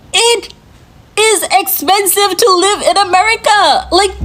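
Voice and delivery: fed-up rant about living costs; exasperated. Real, not acted. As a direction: throw up your hands, voice climbing.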